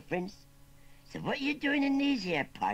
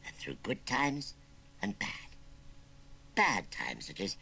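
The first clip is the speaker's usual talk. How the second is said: put-on understated wistful country old-timer; mournful; 3 semitones higher than usual. Old uncle imagining the child he never had, mournful. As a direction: reminisce with a touch of sorrow. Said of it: "went on Wurzel Gummidge mournfully".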